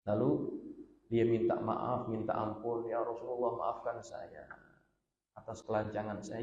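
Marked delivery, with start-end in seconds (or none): 2.9-6.4 softer, humbled plea; guilty companion asking the Prophet's pardon, softly; remorseful apology. Acted. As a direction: beg forgiveness quietly. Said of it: "beliau merasa sangat bersalah".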